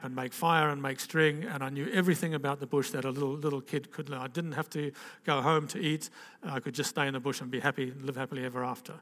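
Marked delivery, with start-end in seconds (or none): none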